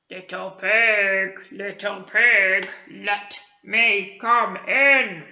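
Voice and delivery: low-ish, slow, looming wolf call. Acted. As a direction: lure them out slowly like a wolf. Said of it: "wolf voice".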